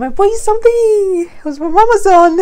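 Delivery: high, loud playful voice; wife teasing husband about the gift, high and excited. Retold or imitated. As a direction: sell the gift with glee. Retelling teasing gift reveal.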